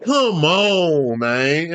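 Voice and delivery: usual speaking voice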